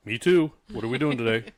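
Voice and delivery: mock hearty reply. Answering a stranger on the phone in the next stall, loud mock-chummy.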